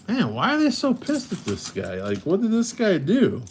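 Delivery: high-pitched puzzled inner voice; mock bewilderment. Recalling an hour and a half of confusion, high baffled voice. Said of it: "I was thinking to myself".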